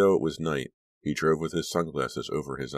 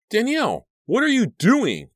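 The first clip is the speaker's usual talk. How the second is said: much higher, louder startled call; shrill call; 8 dB louder than usual. A narrator's reading. Cliff calling to Danielle in the store, high and shrill. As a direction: cut through her daze with a high, sharp call. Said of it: "Cliff's shrill voice broke through".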